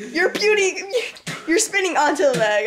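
laughing through the words; can't stop laughing while teasing. Real, not acted. Friend gags, laughs at him.

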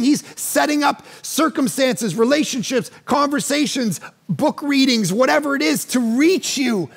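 fervent sermon voice; preaching at full force. Acted. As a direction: preach it hard to the congregation. Preacher's appeal.

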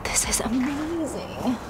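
awed whisper. Real, not acted. Shopper whispering awe to viewers. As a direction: breathe it like a secret.

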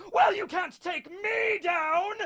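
very high, slow, crazed defiance; unfazed defiant madman. Acted. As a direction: cry it out slowly, undaunted and unhinged. Rox defies enemies alone.

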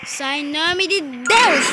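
slow, loud, commanding banishing; loud slow exorcism. Real, not acted. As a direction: cast it out in God's name. Banishing the demon baby. (exorcismo em voz alta)

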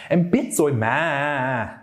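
"mà" drawn out with wobbly fluctuations at the end; imitated emphatic mà. Retelling a woman shouting at her friend, drawn-out emphatic mà. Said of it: "I saw a woman shout at her friend".

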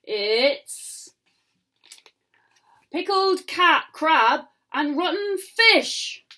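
slow, gleeful pirate offer; pirate grinning as he offers Jack the dish. A narrator's reading. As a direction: savour the gross dish with a grin. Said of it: "grinned the pirates".

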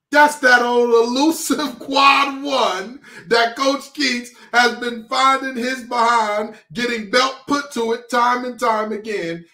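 high, slow, drawn-out exclamation; hyped up. Real, not acted. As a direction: stretch it out high with relish. Hyped, mock-preaching about elusive win.

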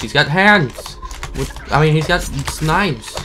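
loud, startled cry of alarm; alarmed outburst. Real, not acted. Gamer alarmed by a knife-armed monster, crying out. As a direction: shout it as the monster lunges.

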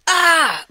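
loud, high anguished cry; sudden loud cry of grief; wordless cry. Acted. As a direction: let out a raw cry of pain. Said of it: "there's just not words".

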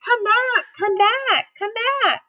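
high, repeated calling; chasers yelling. Acted. Old couple and butcher chasing, high calls. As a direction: keep calling him back. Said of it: "were yelling too".

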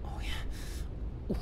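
very soft, whispered, spent murmur; hushed, hammed-up satisfaction; mock-sultry relief. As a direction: let it out on a hushed breath. Mock relief after straining.

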